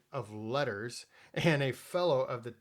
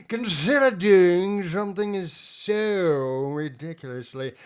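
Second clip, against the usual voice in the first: clipped British officer voice, outraged and louder; General Allenby impression; 4 semitones higher, 7 dB louder than usual. Host mimics General Allenby in British outrage. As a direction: play an indignant old British general, building to a bellow. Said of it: "as General Allenby would have said one day".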